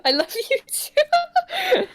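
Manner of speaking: high, slow, sugary squeak; squeaky high voice. Acted. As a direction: stretch it out in a tiny high voice. Replying to a fan message.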